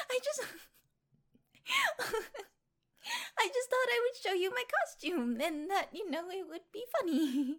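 high-pitched, laughing apology; words bubbling up between giggles; giggly apology. Acted. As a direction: apologise while trying to stop giggling. Apologising amid giggles after prank.

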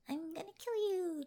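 hint of a playful psycho; psycho killer character. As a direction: play a sing-song killer, half in jest. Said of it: "psycho killer obsessed girlfriend type".

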